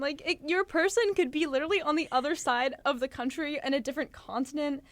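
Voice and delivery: high, loud, giggly excitement; excited laughing. Real, not acted. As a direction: squeal it through laughter. Giddy about meeting a soulmate.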